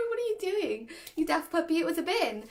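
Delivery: high, singsong teasing of a puppy; over-the-top kids TV presenter chiding; upbeat. Shown as a demonstration. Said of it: "I talk in a very upbeat voice".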